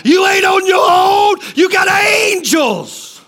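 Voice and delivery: high, sustained pulpit shout; fired-up preacher's cry; full-force preaching. Real, not acted. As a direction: proclaim it with a drawn-out ending.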